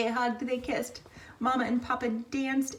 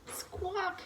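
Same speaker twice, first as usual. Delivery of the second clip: squawk; 10 semitones higher, 6 dB quieter than usual. Acted. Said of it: "said sadly".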